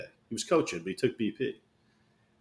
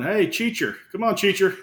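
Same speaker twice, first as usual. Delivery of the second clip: higher-pitched mock holler; imitated coach yell; 7 semitones higher than usual. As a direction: call out like a heckling coach. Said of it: "he starts yelling".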